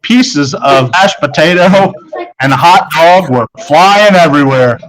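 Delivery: ordinary delivery, nothing marked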